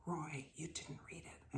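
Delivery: hushed whispered insistence; whisper, not giving up. Retold or imitated. Rector whispering at Roy across the aisle, insisting. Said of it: "And Bernie Campbell said".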